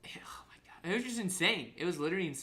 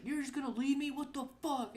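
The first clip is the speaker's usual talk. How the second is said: high-pitched mimicked protest, no real volume; mock screaming; 11 semitones higher than usual. Retelling the son protesting being left by mom, mock-outraged. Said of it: "they were screaming at each other".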